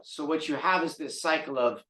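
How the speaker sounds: ordinary talk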